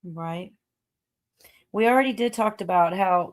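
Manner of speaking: normal talking voice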